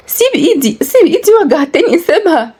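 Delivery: put-on a girl crying out in pain, pitched far up. A narrator's reading. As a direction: plead high and hurt as he grips her hand. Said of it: "بوجع".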